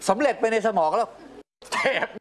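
high, laughing retelling of the wife; wife telling husband she wanted to kick the kids, high and giggly; wife retelling, excited. Retold or imitated. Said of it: "พี่ก็ลุกขึ้นแล้วก็บอกให้ผมว่า".

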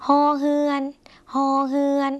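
ฮอเฮือน said high and clearly; name the letter ฮอเฮือน like a teacher; letter name read out. Shown as a demonstration. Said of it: "พยัญชนะตัวต่อไป".

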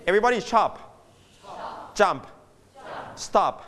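normal talking voice

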